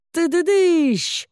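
high, slow sound-effect crash; drawn-out crash sound. Acted. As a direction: sing out the crash slowly.

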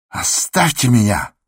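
high, strained outburst; frenzied cry. A narrator's reading. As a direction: cry out as if beside yourself. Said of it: "крикнул он не своим голосом".

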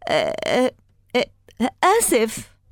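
halting false starts, then a high blurted word; stammer, then squeak out an apology; stuck on the first sound, pitch jumping up at the end; tongue-tied stammer. A narrator's reading. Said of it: "كل ما استطعت نطقه هو".

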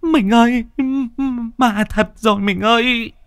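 high, panicked wail to her husband; terrified wife wailing; howling in terror. A narrator's reading. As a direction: howl it out, clutching his neck. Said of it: "Bà Thía hú lên".

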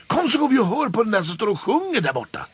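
high, fast and bright; quick lively coaxing; eager invitation. A narrator's reading. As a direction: hurry him away to the singing. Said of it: "sa Gullan".

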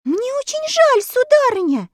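put-on high sweet young girl; polite girl. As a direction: apologise like a little girl. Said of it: "ответила Элли".

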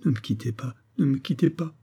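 understated tearful begging; a touch of sobbing, softly begun; sobbing plea. A narrator's reading. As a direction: beg him to stay, pitch climbing on the repeat. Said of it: "dit-elle en sanglotant".

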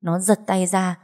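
ordinary delivery, nothing marked

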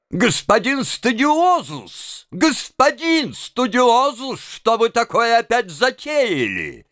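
high, drawn-out outraged scolding; angry cry. A narrator's reading. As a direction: stretch the title out high in exasperation. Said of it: "закричал рассерженный конректор". (сердито, высоким голосом)

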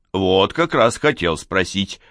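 ordinary delivery, nothing marked

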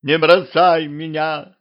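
high, slow, drawn-out plea; plaintive pleading. A narrator's reading. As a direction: beg pitifully not to be left. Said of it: "жалобно попросил он".

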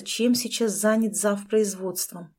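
ordinary delivery, nothing marked

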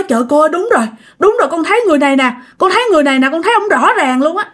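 high, rushed insistence; acted startled recognition. Witness recognises the ghost's photo.

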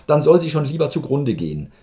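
lauter trotziger Ausruf; verzweifelt laut. A narrator's reading. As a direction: trotzig und verzweifelt hinausrufen. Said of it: "schrie der Major Kowaljow verzweifelt". (der Major ruft dem Arzt laut und verzweifelt nach)

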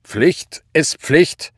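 loud, high salute, slow and stressed; zackig salutierend. A narrator's reading. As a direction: report it like a soldier saluting. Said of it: "salutierte er vor ihr". (zackig salutierend, laut und gedehnt)

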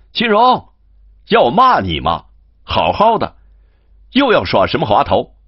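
loud, high call across the road; shouting after him. Acted. Master on horseback shouting at his servant, loud and high. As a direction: yell his name to make him stop. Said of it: "燕西在后面喊道".

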